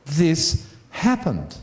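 slow, weighty, every word placed; slow insistence. Real, not acted. Preacher assuring the congregation the event was real, slow and weighty. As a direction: drive home that it is true.

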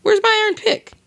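very high-pitched put-on squeak; high squeaky questioning. Gamer hunting for his iron pick, squeaky high voice. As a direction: ask in a tiny, piping voice.

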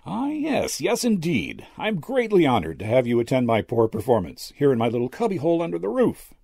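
high, gushing humble host; courtly old medium. A narrator's reading. Old medium flattered by guest.

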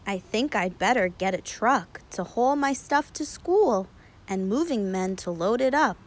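plain reading voice

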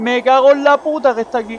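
sudden terrified yell; panicked shout. Real, not acted. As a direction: scream in real panic. Reaper appears during gameplay.

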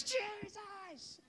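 high scream held back in volume; re-enacted desperate high cry. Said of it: "I'm just crying and screaming".

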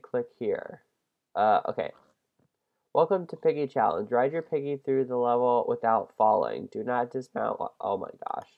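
usual speaking voice

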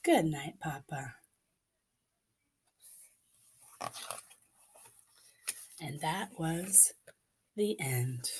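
very soft, slow goodnight; don't wake sleeping papa; soft whisper. Acted.

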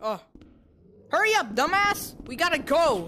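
high panicked yelling; breathless fright. Real, not acted. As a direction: urge them on in panic. Fleeing, urging teammates.

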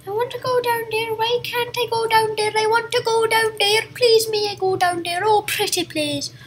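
half-serious whiny plea, running on without a break; mock pleading.

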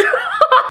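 breathy laugh. Real, not acted. Laughing mid-sentence.

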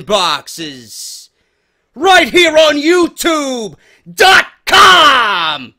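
loud, drawn-out hype host shout; hype announcer intro. Acted. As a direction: bellow it like a wrestling announcer. Hyped show intro announcement.

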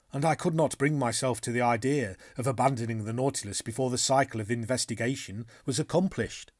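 plain reading voice

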